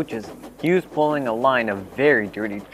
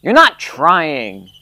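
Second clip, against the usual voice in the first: slow, louder stern rebuke; severe; 5 semitones higher, 8 dB louder, much slower than usual. A narrator's reading. Driver and fireman scold Gordon, slow and stern. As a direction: scold the engine word by word. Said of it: "talking to him severely".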